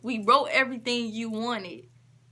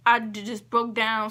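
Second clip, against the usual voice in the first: ordinary delivery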